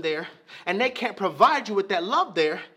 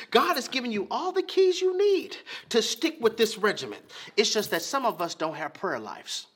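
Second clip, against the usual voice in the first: usual speaking voice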